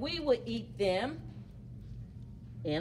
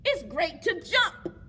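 put-on reading to children. A narrator's reading. Teacher reading My Many Colored Days, storytime voice. Reads picture book aloud.

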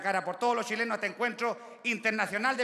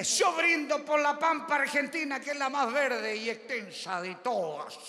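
slow, high, grand toast; pompous Argentine toast; 6 semitones higher, slower than usual. Retold or imitated. Argentine gaucho toasts the pampa to the hall, pompous and slow. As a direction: raise the glass like a proud gaucho. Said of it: "Y dice".

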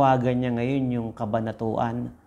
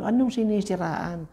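higher, scoffing "what smear?"; scoffing retort; 6 semitones higher than usual. Acted. Preacher scoffing back at critics who cry smear, higher mocking retort. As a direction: toss it back in disbelief.

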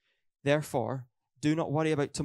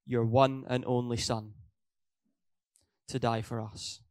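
led prayer. Acted. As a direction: lead the congregation phrase by phrase. Leads a prayer.

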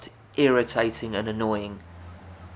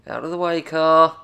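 shouting at traffic; 6 semitones higher, 7 dB louder than usual. Real, not acted. Shouting at a car in game.

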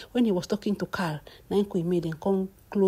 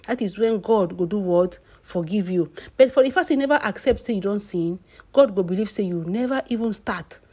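ordinary talk, nothing marked

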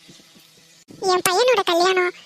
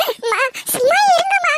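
tearful plea; 7 semitones higher than usual. Acted. Daughter begs mother again.

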